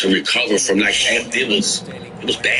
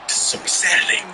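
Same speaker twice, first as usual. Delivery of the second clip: ordinary delivery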